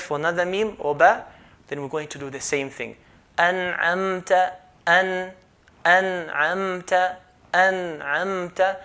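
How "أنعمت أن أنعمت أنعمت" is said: أنعمت with the mim touched briefly; light mim. Shown as a demonstration. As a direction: let the mim go at once in أنعمت. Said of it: "You don't need to hold that".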